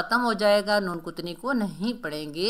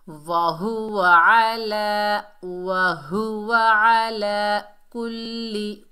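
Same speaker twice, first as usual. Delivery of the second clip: slow, loud, drawn-out syllables; measured recitation; 5 dB louder, much slower than usual. Shown as a demonstration. Teacher models the phrase, slow recitation. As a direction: recite slowly with rounded w and held vowels.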